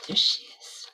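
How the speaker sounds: whispered aside; soft unvoiced aside. Real, not acted.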